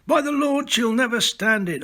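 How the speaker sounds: first mate crying out in alarm. A narrator's reading. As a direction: cry out that the ship won't hold. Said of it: "our first mate Jackson cried".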